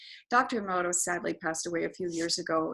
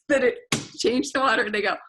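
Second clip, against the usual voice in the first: normal talking voice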